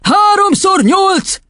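very high, drawn-out yell; howling. A narrator's reading. Headmistress dangling the boy by his ankle, howling. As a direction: yell it slowly in fury. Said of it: "üvöltötte Doron kisasszony".

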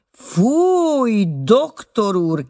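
"Fúj" shrieked high and loud, then slow reproach; a scandalized outcry; shocked cry. A narrator's reading. The butler cries out at the young man's scandalous joke, loudly. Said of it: "kiáltott Kesselhut".

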